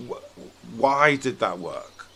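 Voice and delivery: ordinary delivery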